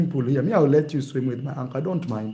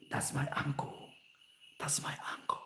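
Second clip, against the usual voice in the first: very soft, whispered aside; hushed whisper; 3 semitones lower, 19 dB quieter than usual. Acted. Nephew commenting on his uncle swimming, whispered. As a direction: whisper it under your breath. Acts himself watching uncle swim.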